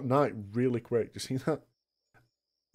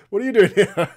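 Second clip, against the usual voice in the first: laughing surprised shout; 7 semitones higher, 12 dB louder than usual. Real, not acted. Greets surprise game character laughing.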